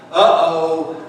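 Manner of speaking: low, dismayed uh-oh; retold "oops, I'm caught" in a low voice; sinking realization. Pastor sees the missed call, sinking realization.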